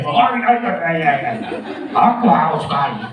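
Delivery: lightly voiced Mr. Krabs; a hint of the crab captain, thirsty. Shown as a demonstration. Trainer demoing Tuan Crab for teachers, light character voice. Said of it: "Lalu datang Tuan Crab".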